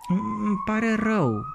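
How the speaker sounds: slow, high bewildered apology; puzzled boy. A narrator's reading. Puzzled boy apologises to the cauliflower, bewildered. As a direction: say sorry confused. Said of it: "răspunse copilul nedumerit".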